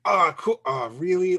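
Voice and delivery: retold hopeful reaction deflating; excited, then let down. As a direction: start high and loud, ease into letdown. Excitement turning to letdown, retold.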